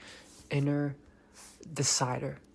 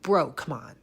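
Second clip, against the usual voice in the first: ordinary talk, nothing marked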